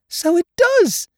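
very high, loud squeak; amazed Piglet. A narrator's reading. Piglet seeing the balloon fit, amazed squeak. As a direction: squeal it slowly in delight.

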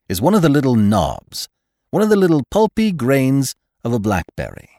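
high, loud, emphatic explanation; severe doctor. A narrator's reading. Doctor explains a drupelet to the sulky father, severely. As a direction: drive the definition home forcefully.

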